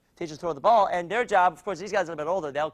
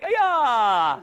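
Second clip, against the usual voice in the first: high, drawn-out kiai falling away; martial arts yell; 6 semitones higher, 4 dB louder than usual. Shown as a demonstration. Coach leads kids' front kick, kiai yell. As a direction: let the cry ring with the kick. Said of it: "yell out".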